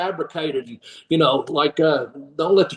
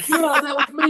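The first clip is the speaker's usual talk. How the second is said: high-pitched, thrilled confirmation; excited fan; 9 semitones higher than usual. Retold or imitated. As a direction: imitate a fan thrilled to be recognized.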